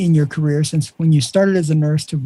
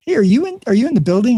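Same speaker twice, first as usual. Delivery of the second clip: pitched way up, a hopeful checking-in question; questioning call; 6 semitones higher, faster than usual. Retold or imitated. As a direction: ask it high and light, half unsure he's there. Nurse phoning a doctor, quoted.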